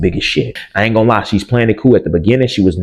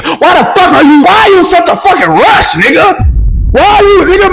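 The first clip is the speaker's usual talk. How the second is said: frantic high yell; 6 dB louder than usual. Retold or imitated. Yelling at the stepdad retold.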